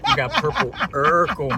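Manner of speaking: loud laughing exclaim. Real, not acted. Laughing at the dealer's weak weed.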